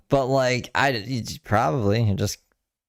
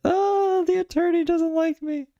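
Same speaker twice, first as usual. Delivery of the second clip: much higher, whiny mock voice; mocking falsetto. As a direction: tease her with a squeaky put-on whine. Mocking cohost's upset reaction.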